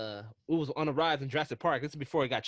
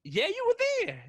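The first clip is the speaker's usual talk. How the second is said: much higher insisting reply; incredulous; slower than usual. Real, not acted. Reminding a forgetful friend, incredulous high insistence. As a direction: remind him he was right there. Teasing friend who forgot.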